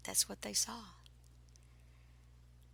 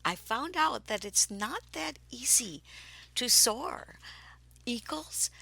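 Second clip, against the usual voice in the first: ordinary talk, nothing marked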